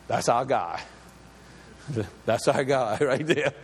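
a lightly voiced crowd, chuckling; proud of their leader, with a laugh; a hint of a delighted crowd; Israelites cheering.

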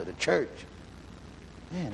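usual speaking voice

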